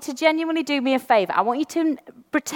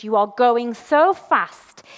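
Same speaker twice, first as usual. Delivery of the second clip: slow, deliberate priest's rebuke; slow quote; much slower than usual. Retold or imitated. Priest stopping her reading, slow and deliberate. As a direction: spell it out slowly. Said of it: "He said".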